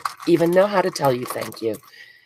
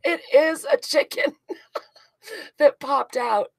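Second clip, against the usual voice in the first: high giggly words, slowing down; delighted giggle at the pop-out chicken; laughing; 11 semitones higher than usual. Real, not acted. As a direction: laugh through the reveal.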